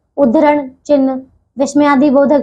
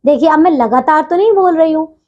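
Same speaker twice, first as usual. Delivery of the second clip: usual speaking voice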